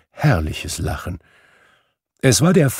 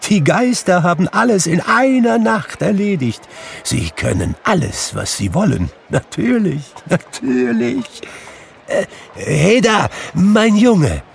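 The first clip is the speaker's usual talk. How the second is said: delighted exclamation; 11 semitones higher, 4 dB louder than usual. Acted. Scrooge delighted, then calls boy.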